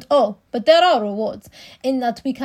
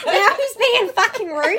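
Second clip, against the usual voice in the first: laughing; 9 semitones higher, 5 dB louder, slower than usual. Real, not acted. Laughing mock complaint.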